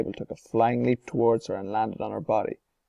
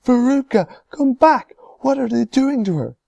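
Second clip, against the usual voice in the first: panicked cry; 14 semitones higher, 9 dB louder than usual. A narrator's reading. Mother panics.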